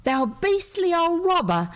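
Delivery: high, hammed-up stage yell; boy yelling at an old robber; mock shouting. A narrator's reading. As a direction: hurl the insult, playacting. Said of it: "Douglas was shouting".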